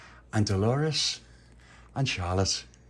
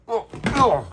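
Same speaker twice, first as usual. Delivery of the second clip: ordinary delivery, nothing marked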